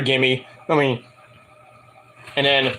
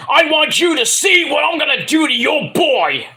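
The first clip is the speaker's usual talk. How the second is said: yelling; 9 semitones higher than usual. Retold or imitated. Wrestler threatening the announcer about his boy, yelling. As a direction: yell it as an angry threat. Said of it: "starts yelling at Vic".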